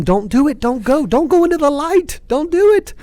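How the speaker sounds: loud, climbing cry of warning; fired-up plea. Acted. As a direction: plead at rising pitch, as if calling someone back from danger. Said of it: "I'm getting a little fired up".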